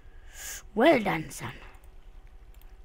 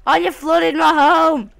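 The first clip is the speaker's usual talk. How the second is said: loud, higher, slow furious yell; outraged shout; 7 semitones higher, 11 dB louder, slower than usual. Real, not acted. Player shouting at mobs that flooded his home. House destroyed.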